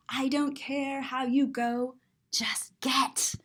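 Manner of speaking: whispered impatience; hushed, unvoiced get-going line. A narrator's reading. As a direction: hiss it softly, shooing him off.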